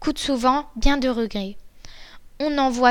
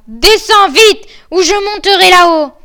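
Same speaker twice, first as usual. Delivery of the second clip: full-force shout; 6 semitones higher, 10 dB louder than usual. A narrator's reading. Bluebeard orders his wife down from upstairs, shouting with all his might. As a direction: bellow a threat up the stairs. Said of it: "criait de toutes ses forces à sa femme".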